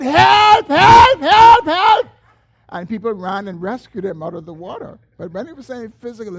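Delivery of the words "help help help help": very high, very loud yells of help; frantic shout for help. Retold or imitated. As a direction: scream for help while drowning. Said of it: "he said".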